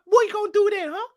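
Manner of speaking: ordinary talk, nothing marked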